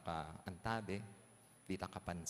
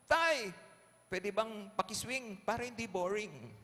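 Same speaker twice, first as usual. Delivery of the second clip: put-on high-pitched kid asking dad a favour; acted playful kid punchline; cheeky child; 12 semitones higher, 4 dB louder than usual. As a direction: call out loudly to dad, then ask in a high child's voice.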